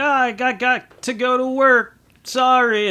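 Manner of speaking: high, put-on apologetic brush-off; mock excuse. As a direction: play up a fake work excuse. Fake excuse to skip holiday.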